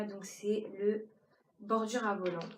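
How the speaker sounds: ordinary talk, nothing marked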